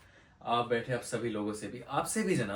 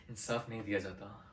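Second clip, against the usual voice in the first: softer, lower, grave statement; subdued repetition; 4 semitones lower, 7 dB quieter than usual. Acted. Lawyer telling the judge the poor get no justice, subdued. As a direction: let it sink in quietly. Lawyer repeats his point softly.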